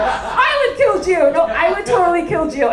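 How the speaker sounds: hammed-up cast member insisting on her murder plan; loud, high, sing-song valley-girl take-off; mock reality star. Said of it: "They're just like".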